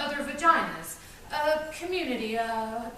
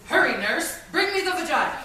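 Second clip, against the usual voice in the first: loud, higher mock operating-room order; urgent doctor; 4 semitones higher, 6 dB louder than usual. Doctor demanding an instrument from the nurse, urgent and loud.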